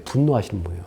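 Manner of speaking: ordinary talk, nothing marked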